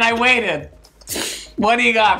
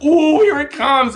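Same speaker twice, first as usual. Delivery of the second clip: higher, louder, slow gleeful ooh; gleeful anticipation; 8 semitones higher, 5 dB louder than usual. Retold or imitated. Waiting for [name]'s read, a higher gleeful excited ooh. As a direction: savor the drama about to land. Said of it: "And I was like".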